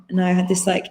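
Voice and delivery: normal talking voice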